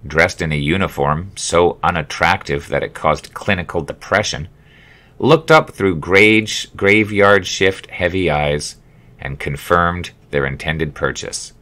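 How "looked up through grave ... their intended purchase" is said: ordinary delivery, nothing marked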